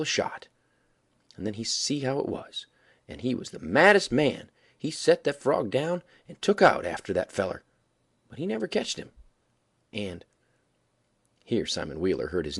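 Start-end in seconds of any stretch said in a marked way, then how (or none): none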